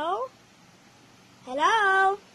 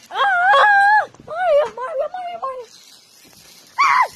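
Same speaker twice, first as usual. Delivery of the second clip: high, loud panicked calls; panicked shouting; 10 semitones higher, 13 dB louder than usual. Real, not acted. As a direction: scream for help. Panicked calling Mario.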